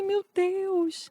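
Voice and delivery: high-pitched mock wail of relief; retold overwhelmed exclamation.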